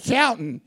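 Shouting said through fake teeth; fake-teeth lisp. Shown as a demonstration. Preacher jokes to the congregation, said with fake teeth. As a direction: mush the word Shouting as if through dentures. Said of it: "I said that with my fake teeth".

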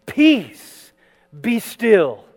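high, loud command to the sea; commanding rebuke. Acted. Jesus commanding the wind and sea, authoritative. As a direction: order the storm to stop. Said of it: "rebuked ... the wind, and said to the sea".

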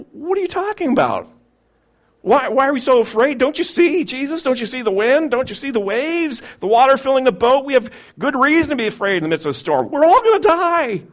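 high, loud frantic protest; exasperated protest. Acted. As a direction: argue back in panic. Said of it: "disciples wanting to answer back".

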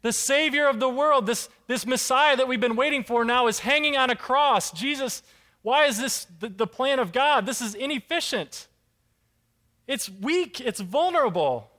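a believer doubting aloud at the cross; anguished doubt. Retold or imitated. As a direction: question God in exasperated bewilderment. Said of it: "it has to be going through his mind".

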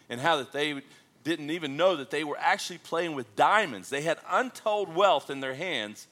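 rising, emphatic sermon voice; preaching. Acted. Pastor preaching to the congregation, emphatic and rising. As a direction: drive each point home to the church.

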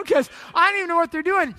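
ordinary talk, nothing marked

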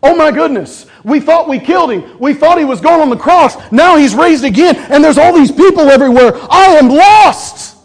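high, loud, dismayed devil voice; hammed-up alarmed Satan; panicked enemy. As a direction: play a rattled, beaten enemy. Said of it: "the enemy's standing there going".

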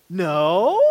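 loud, rising innocent no; smiling child's denial. Retold or imitated. Child covered in crumbs denying the cookie, feigned innocence. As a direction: fib with a big questioning lift. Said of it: "looks at me and smiles and goes".

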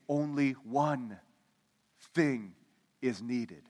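slow, spaced out key phrase; emphatic slow. Acted. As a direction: weigh each word. Sermon's key point slowed.

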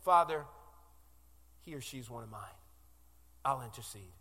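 a gentle advocate speaking up for one of his own; voicing Jesus. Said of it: "and Jesus, the advocate, says".

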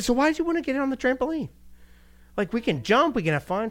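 puzzled playful father; dad asking daughter why she came, coaxing. Retold or imitated. Father asks daughter in story.

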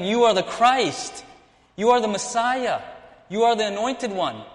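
high, loud, emphatic acted proclamation; gleeful proclamation. Preacher as Peter hailing the Christ, gleefully proclaiming. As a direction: proclaim it joyfully, drawing out each title. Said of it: "Peter was gleefully proclaiming".

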